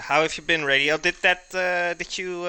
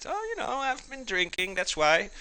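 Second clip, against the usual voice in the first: mock sheepish excuse; 9 semitones higher than usual. Acts out John's drinking excuse.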